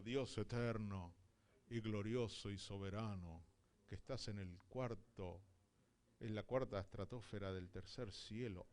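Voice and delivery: mock a stiff, overblown prayer to a distant God; pompous formal prayer. Pastor mocks how people pray, low and pompous. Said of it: "Él no dijo".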